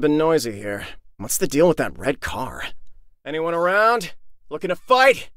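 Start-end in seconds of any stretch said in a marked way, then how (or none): 3.2-5.3 higher-pitched challenging call, rising at the end. Acted. Fighter looking for anyone to fight, calling out.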